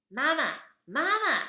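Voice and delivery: tiny high squeaky chick voice; high peeping baby bird; baby gosling peep. Acted.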